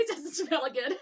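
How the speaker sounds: laughing through the line. Real, not acted. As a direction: repeat it while cracking up. Laughing echo of partner's joke.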